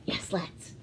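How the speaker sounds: unvoiced little whisper. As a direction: whisper it like a secret answer. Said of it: "whispered self-answer".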